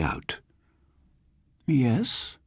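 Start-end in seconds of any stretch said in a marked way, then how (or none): 1.7-2.4 put-on high, curious old woman's voice; lightly voiced curious old lady; inquiring old lady. A narrator's reading. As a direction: peer out and ask like an elderly lady. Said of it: "she inquired".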